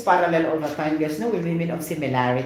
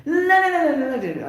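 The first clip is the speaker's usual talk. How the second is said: much higher and louder sing-song la la la; lively; 12 semitones higher, 4 dB louder than usual. Shown as a demonstration. Teacher showing how lively modulation should sound. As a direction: bounce it up high with lots of life. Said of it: "You need to emphasize really lively".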